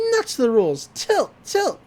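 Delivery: imitated egging-on, leaping very high; Mabel. Girl urges her friend to tilt the machine, high mock-excited chant. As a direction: squeal the chant like an excited kid. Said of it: "Mabel's like".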